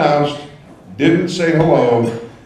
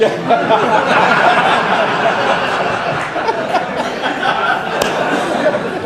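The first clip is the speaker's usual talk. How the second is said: breathy laughing; 12 semitones higher than usual. Real, not acted. Audience roars at punchline.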